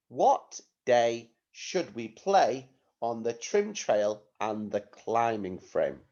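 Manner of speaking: slow, deliberate phrase by phrase; teacher reading out slowly for writing; slow dictation. Shown as a demonstration. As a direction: dictate it carefully with small gaps. Teacher dictating sentence to pupils.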